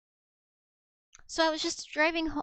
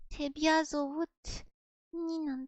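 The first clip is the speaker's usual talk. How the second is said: normal talking voice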